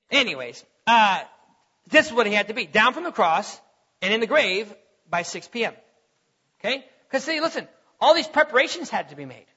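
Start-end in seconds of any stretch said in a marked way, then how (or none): none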